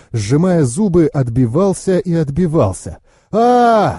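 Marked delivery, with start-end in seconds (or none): 3.3-4.0 sustained narrated yell, pitched up; battle scream. A narrator's reading. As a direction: cry out on one long raised vowel. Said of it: "закричал я".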